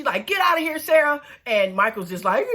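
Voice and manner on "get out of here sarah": high-pitched mock telling-off; angry dismissal. Maria sending Sarah away, angry dismissal. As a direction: imitate shooing a rival away, pitched up.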